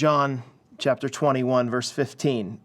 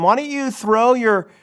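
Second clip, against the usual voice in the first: raised, carrying call, retold from the pulpit; retold yell; 8 semitones higher, 5 dB louder than usual. Stranger on the beach to the fishermen, imitated yell. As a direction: imitate a stranger calling out, higher and louder. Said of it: "he yells out to them".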